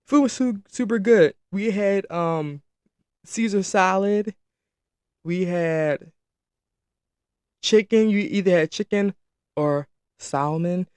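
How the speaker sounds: ordinary talk, nothing marked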